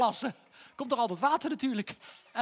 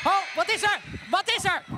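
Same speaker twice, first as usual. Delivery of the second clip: loud, higher panicked calls; alarmed shout; 6 semitones higher, 5 dB louder than usual. Acted. Bobby startled by trouble at the barrel, shouting. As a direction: call out in sudden alarm. Alarm, something goes wrong.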